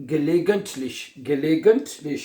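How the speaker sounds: slow, syllable by syllable gelegentlich, each part drawn out; slow teaching model. Shown as a demonstration. Teacher drawing out gelegentlich slowly for students. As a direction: say gelegentlich slowly for learners to repeat. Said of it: "क्या बनेगा?".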